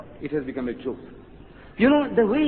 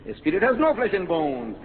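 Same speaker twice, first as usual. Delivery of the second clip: usual speaking voice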